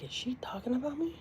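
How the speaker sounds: breathy whispered disbelief. Retold or imitated. Whispering it again while recalling the live. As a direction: hush it to yourself.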